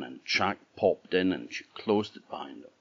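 ordinary delivery

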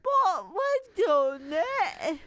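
choking up on the words; tearful. Real, not acted. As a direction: voice catching with tears. Emotional talk, crying heard.

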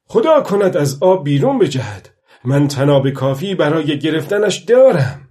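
high, loud wishful call; calling out aloud. A narrator's reading. Old fisherman wishing the fish would jump, calling out aloud. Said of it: "پیرمرد گفت".

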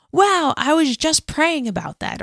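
high, drawn-out mock wonder; imitated amazement. Person just prayed for marvels at it, imitated amazement. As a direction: voice someone pleasantly astonished, slowly.

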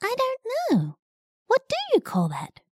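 high puzzled bug voice; a curious little caterpillar. Acted. Squish puzzled by the joke.

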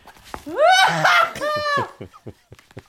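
big shrieking laugh; loud burst of laughter. Real, not acted. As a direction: howl with laughter. Laughing at flammable boots joke.

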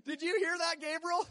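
high, laughing question; God laughing to Gabriel at a lie. Pretend. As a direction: still chuckling, ask Gabriel. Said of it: "What does God do when he hears a lie".

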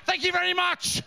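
high-pitched mock Northern Irish outrage; aggressive Northern Irish. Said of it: "and you're going".

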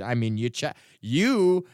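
ordinary talk

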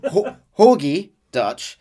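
normal talking voice

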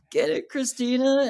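high-pitched excited cheer; starstruck cheer. Retold or imitated. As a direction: cheer her on in a high squeal. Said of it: "And I'm like".

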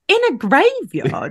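put-on incredulous Northern Irish accent, high. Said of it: "Alan with his thick Northern Irish accent".

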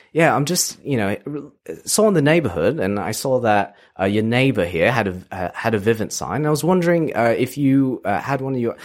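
put-on door-to-door salesman faking a British accent; terrible British accent. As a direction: pitch the alarm system in a bad British accent. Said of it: "it's like this terrible British accent".

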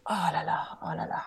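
weary French sigh, fading to a whisper; grumpy French "oh la la". Shown as a demonstration. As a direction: grumble it like a tired Frenchman. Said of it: "like that".

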